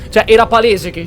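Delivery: usual speaking voice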